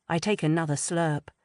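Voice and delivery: ordinary delivery, nothing marked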